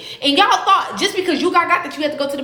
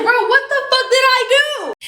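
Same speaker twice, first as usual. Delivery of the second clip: shocked; 12 semitones higher, 4 dB louder than usual. Retold or imitated. Reads twenty thousand dollar bond.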